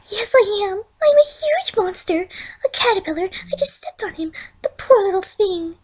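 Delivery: a shy pony mourning, hushed at the end; high, meek Fluttershy voice; timid pony voice. A narrator's reading. Fluttershy voiced, grieving caterpillar.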